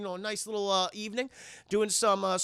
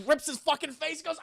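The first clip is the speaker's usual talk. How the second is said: ordinary talk, nothing marked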